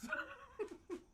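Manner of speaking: squeaky high giggle; giggling at his content-creator joke. Real, not acted. Laughing at his own joke.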